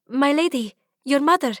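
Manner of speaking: maid voice. Acted. Nuri, the maid, announcing.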